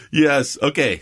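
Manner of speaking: ordinary delivery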